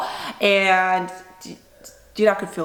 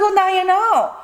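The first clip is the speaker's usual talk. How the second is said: much higher, mock-triumphant; high sarcastic retort; 9 semitones higher, 4 dB louder than usual. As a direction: toss it back sarcastically, pitched up. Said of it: "I was like".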